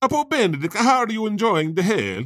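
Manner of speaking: high, lilting Italian voice as Dante; hammed-up Italian Dante accent. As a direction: greet the pope like a cheery Italian poet. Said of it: "Dante's like".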